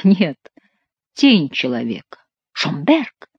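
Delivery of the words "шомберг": mild questioning cry; astonished exclamation. A narrator's reading. King sees Schomberg appear, astonished exclamation. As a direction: exclaim the name in disbelief. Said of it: "воскликнул король".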